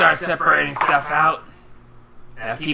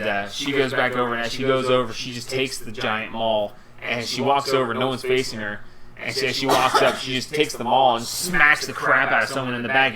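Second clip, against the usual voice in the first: ordinary talk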